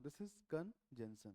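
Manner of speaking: slow careful "This is conjunction"; slow model reading. Shown as a demonstration. Teacher models "This is conjunction" slowly to the class. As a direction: read each syllable out slowly. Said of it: "अब पढ़ो".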